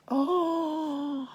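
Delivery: high, loud, held "oh"; long exclaimed oh. Shown as a demonstration. Teacher showing off Tinkerbell wings, long loud high oh. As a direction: let a long oh ring out.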